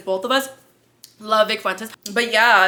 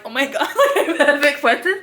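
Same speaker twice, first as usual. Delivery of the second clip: higher-pitched, laughing disbelief; giddy retelling; 5 semitones higher than usual. Retold or imitated. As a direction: imitate starstruck friends through laughter. Acts friends returning thrilled, laughing.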